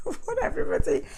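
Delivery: words breaking up with laughter; high, giggly restart; laughing. Real, not acted. Laughs while restarting the sentence.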